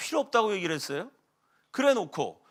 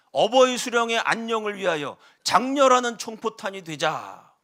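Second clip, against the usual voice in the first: normal talking voice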